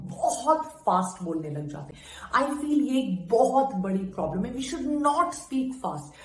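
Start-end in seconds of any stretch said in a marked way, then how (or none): 4.5-6.1 very slow and deliberate; slow demo. Shown as a demonstration. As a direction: stretch each word out, show slowness. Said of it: "हमेशा सोचकर slowly बोलना चाहिए". (बहुत धीरे बोलकर दिखाना)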